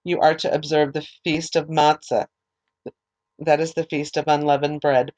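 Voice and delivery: ordinary delivery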